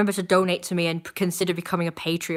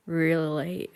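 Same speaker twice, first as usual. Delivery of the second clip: usual speaking voice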